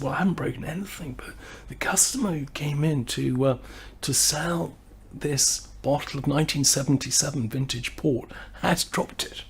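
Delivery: whispering the bad news; breathy whisper, like he's confiding trouble; hushed report. [name] whispers the bad news to the shopkeeper, hushed.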